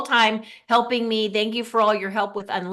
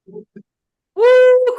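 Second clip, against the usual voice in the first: excited cheer; 10 dB louder than usual. Real, not acted. Host hypes the guest's entrance.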